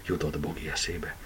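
ordinary delivery

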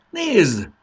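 high, loud call that drops; excited cry. A narrator's reading. Marci points out the cow to Fanny, crying out. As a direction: cry out to make someone look. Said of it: "kiáltott fel Marci".

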